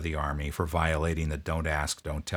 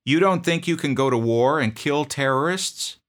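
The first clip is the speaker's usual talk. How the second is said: loud, higher, incredulous sergeant; rising anger; 8 semitones higher, 7 dB louder than usual. Retold or imitated. Sergeant growing loud at a doubting private, incredulous. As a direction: get louder as you challenge him. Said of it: "My voice started to get loud".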